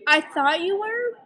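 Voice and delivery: high, drawn-out imitation of a friend; acted high-pitched admission; friend's surprised admission. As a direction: blurt it slowly up high, mimicking a friend. Acts out friend's reaction to news.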